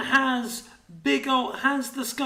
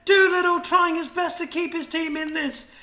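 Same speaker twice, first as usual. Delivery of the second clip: fast, raised, urgent fight calling; live play-by-play; 6 semitones higher, 4 dB louder than usual. Real, not acted. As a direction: call the fight as it unfolds. Live team fight commentary.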